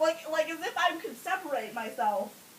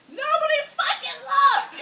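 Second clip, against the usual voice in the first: high, loud frantic yell; hammed-up desperate shout; 12 semitones higher, 8 dB louder than usual. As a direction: holler it at the whole room. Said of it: "So I yell out at everybody".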